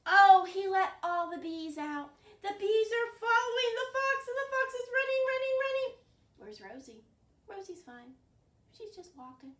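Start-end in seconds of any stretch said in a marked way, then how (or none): 0.1-5.9 high, loud breathless storytelling; excited chase telling. Pretend. Reader telling kids about bees chasing fox, high and loud.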